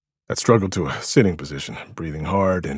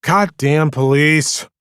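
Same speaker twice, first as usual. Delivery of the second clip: resigned; 8 semitones higher, 7 dB louder, slower than usual. A narrator's reading. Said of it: "Diggs said in a resigned voice".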